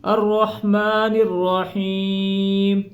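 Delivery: very slow, tuneful recitation; drawn-out recitation; melodic recitation. Shown as a demonstration. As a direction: chant the phrase out long. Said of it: "recitation repeated".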